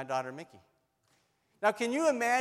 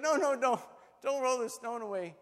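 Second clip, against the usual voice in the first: high, hurried-sounding refusal; mock alarmed protest; 6 semitones higher than usual. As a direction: object urgently in a raised pitch. Acted protest against opening the tomb.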